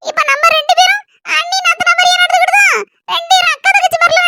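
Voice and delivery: high-pitched, firm sister-in-law; high put-on voice, adamant; insistent sister-in-law.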